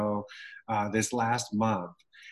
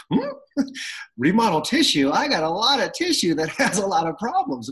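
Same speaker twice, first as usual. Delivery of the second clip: high, comically baffled cartoon-dog reaction; playful high-pitched puzzlement with a laugh; Scooby-Doo; 8 semitones higher, 5 dB louder than usual. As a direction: perk up in mock confusion, laughing through it. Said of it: "I felt like Scooby-Doo".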